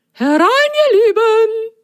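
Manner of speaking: very high, loud, sing-song welcome; very high loud fairy-tale fruit voice; lemon's voice. Acted. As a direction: welcome them like a kindly giant lemon. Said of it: "ertönte eine Stimme".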